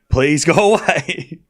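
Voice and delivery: mock fed-up brush-off. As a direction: tease him to back off, half laughing. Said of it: "turn around like Zverev did against Fritz and say".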